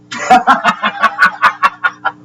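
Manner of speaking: unvoiced laugh rising high; high breathy laugh. Real, not acted. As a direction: burst into a breathy giggle. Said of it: "you can tell I'm having fun now".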